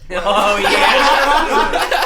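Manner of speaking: high, loud laughing exclamation; cracking up mid-word. Real, not acted. Bursts out laughing at memory.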